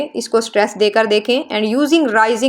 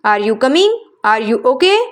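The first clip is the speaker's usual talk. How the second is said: questions climbing on "coming" and "okay"; rising intonation; much slower than usual. Shown as a demonstration. Teacher demonstrating questions to students, rising intonation on "coming" and "okay". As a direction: start level, lift "coming" and "okay" upward. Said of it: "rising intonation के हवाले से".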